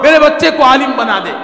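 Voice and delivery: loud, high, fervent preaching; impassioned preaching. Acted. Preacher telling of the weeping mother's prayer, high and fervent.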